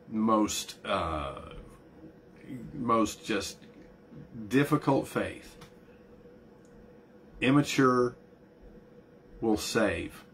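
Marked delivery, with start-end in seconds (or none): none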